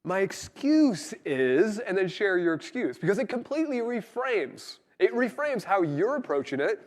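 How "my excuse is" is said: higher, louder and very slow, rising at the end; slow emphatic phrase. Shown as a demonstration. Wife answering her husband about being late, slow and emphatic. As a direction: hand the phrase to your husband. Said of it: "Just say".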